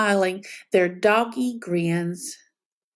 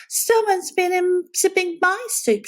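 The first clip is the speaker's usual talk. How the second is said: higher bear voice with a playful growl; growling Mama Bear; 10 semitones higher than usual. A narrator's reading. Mama Bear finding her soup sipped, mock growl. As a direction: voice the mother bear, lightly cross. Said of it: "she growled".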